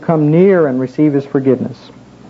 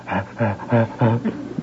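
soft, breathy huffs in a steady row; low, quiet imitation of a dog's breathing; imitated dog panting; 6 semitones lower, 8 dB quieter than usual. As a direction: pant like a dog right beside someone. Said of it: "all of a sudden I heard this".

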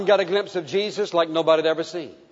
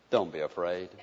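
tender comfort; 11 semitones lower, 9 dB quieter than usual. Acted. Jesus comforting John.

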